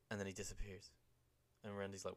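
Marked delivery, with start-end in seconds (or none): none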